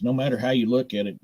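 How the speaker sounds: ordinary talk, nothing marked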